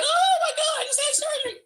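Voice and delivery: high-pitched, hammed-up victim cry; milk the injury in a showy wail; mock tearful wail. Playing injured for the arriving cops, mock tearful wail. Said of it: "I had tears coming out my eyes".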